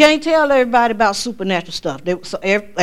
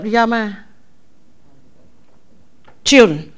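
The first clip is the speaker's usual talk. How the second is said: normal talking voice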